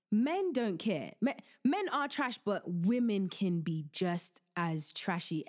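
normal talking voice

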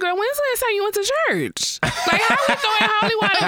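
high, fast, laughing disbelief; incredulous high retort. Retold or imitated. Mocking a friend's holy-water talk, high and incredulous. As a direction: fire it back high and fast.

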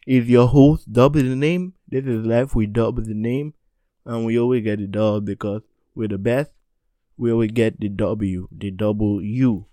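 loud, pumped-up show sign-off; hype host outro. Acted. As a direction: close the show like a hype announcer.

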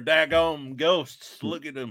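put-on drawn-out hillbilly Southern accent, slowed way down; mock Southern drawl. Friend mocking Emily's accent on a ghost show, Southern drawl. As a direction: play up a thick Southern accent for laughs. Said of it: "Emily be good on a ghost show".

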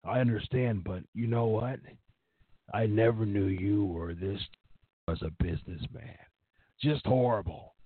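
hammed-up imitation of an affronted boss; Vince McMahon. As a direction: start understanding, then turn on them in disgust. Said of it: "that's exactly what Vince McMahon would do".

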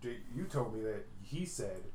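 ordinary delivery

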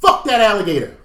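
high, loud defiant yell; loud mock scream from the headline. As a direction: yell it like a reckless last scream.

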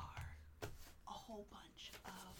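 soft unvoiced whisper. Real, not acted. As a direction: whisper it as if monsters might hear. Whispering the situation report.

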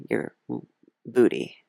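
ordinary talk

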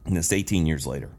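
real sigh, then low and soft; subdued, softly admit the lingering anxiety. Real, not acted. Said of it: "I can still feel the anxiety a little bit".

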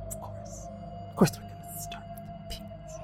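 breathy whisper, high and far; a knowing whispered aside. Acted.

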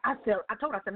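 ordinary talk, nothing marked

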